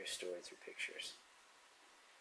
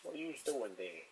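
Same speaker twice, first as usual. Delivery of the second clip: put-on Brooklyn accent, pitched up, tough-guy bark; 8 semitones higher than usual. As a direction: call out like a Brooklyn wiseguy from the forties. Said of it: "Suddenly he's from Brooklyn".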